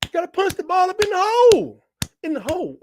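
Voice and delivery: football voice demo. Shown as a demonstration. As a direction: show off the screaming you do at games. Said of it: "Oh, you talking about this".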